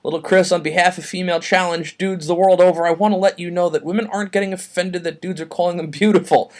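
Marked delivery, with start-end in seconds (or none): none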